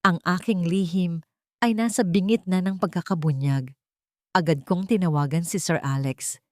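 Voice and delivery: usual speaking voice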